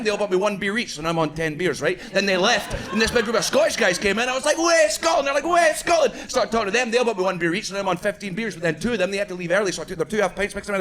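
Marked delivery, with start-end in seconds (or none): 4.6-5.3 high shouted cheer; loud pub greeting for fellow Scots. Retold or imitated. 5.4-6.1 shout. Retold or imitated. Greeting fellow Scots in bar.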